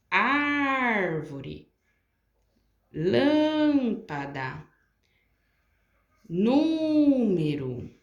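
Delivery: Árvore, lâmpada, número with the first syllable stretched long, said slowly; prolonged antepenultimate syllable. Shown as a demonstration. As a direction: drag out the first syllable of each word. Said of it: "eu prolonguei em todas elas a antepenúltima".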